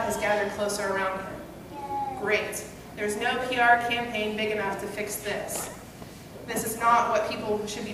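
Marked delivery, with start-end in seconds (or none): none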